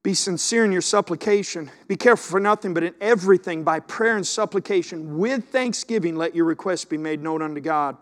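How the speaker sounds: loud, driving scripture recitation; forceful preaching. Real, not acted. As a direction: proclaim it to the back row.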